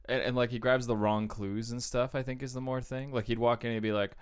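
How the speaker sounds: ordinary talk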